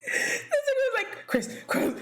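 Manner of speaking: high laughing squeal; laughing so hard the voice jumps up; cracking up while retelling. Real, not acted.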